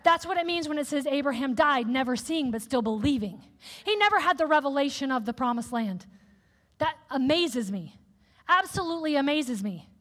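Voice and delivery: usual speaking voice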